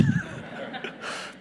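light, airy burst of laughter; amused laugh. Real, not acted. Preacher laughs at son's remark.

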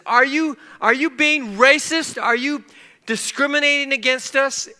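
high, loud, indignant questioning; really loud accusation. Retold or imitated. Angry diner accusing servers of discrimination, in a really loud voice. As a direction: shout the accusation for all to hear. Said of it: "said it in a really loud voice".

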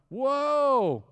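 a high, slow, stretched-out whoa; amazed whoa. Retold or imitated. Reacting to sped-up Bible audio.

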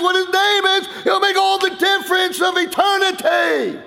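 high, loud desperate plea for his name; pleading cry. Acted. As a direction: beg the crowd to tell you, full voice. Preacher pleading at full force.

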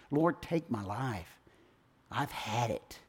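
slow, soft, defeated Elijah; despairing sigh. Acted. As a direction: let the words sink in hopeless. Elijah despairing, wants to die.

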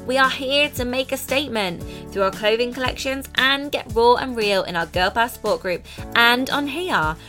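upbeat podcast intro read; bright presenter pitch over music; booming motivational speech. Acted.

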